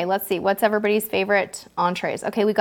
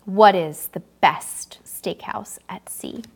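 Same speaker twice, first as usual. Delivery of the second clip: slow whispered question, drawn out for suspense; dramatic whisper; 5 semitones lower, slower than usual. Shown as a demonstration. Host teasing the next quiz question to viewers in a slow whisper. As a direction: whisper it slowly to build suspense.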